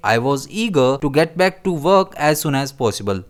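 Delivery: each word of the sentence read very slowly and clearly; teacher dictating an example sentence. Shown as a demonstration. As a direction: read it out slowly so learners follow. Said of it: "हम इसे कैसे use करेंगे".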